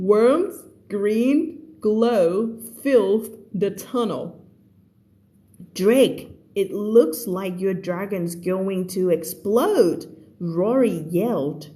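slow, deliberate reading aloud; story read-aloud. Acted. Teacher reading the story to online students, slow read-aloud. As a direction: read it out slowly, word by word.

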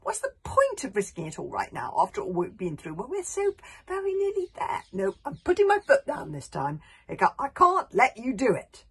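loud, high, putting his foot down; refusing to let Hiccup risk it all; firm refusal. A narrator's reading.

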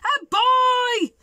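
high, loud alarmed shout; big storybook holler; yelled. A narrator's reading. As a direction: yell it as a frightened bear. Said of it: "yelled the bear".

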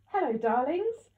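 high, loud, slow forced cheer; bright and brave. A narrator's reading. Mother greeting her family after crying, bravely bright and loud. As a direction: sing it out brightly to hide the hurt. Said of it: "Mum said brightly and bravely".

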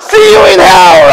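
loud, high joking curse through a laugh; laughing fake fury; mock angry, laughing. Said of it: "I just, like".

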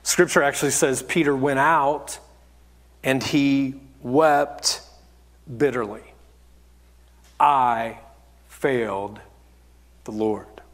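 hushed, desolate fall; grieving solemnity. A narrator's reading. Grave telling of Peter weeping.

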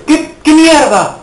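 shrieking "qué mierda" at the screen; outraged yell. Real, not acted. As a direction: explode in disbelief. Gamer frustrated at game.

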